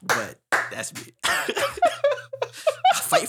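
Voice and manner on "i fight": slow, high, laughing proclamation. Real, not acted. Proud student declaring loyalty, slow and laughing. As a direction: stretch it out through a laugh.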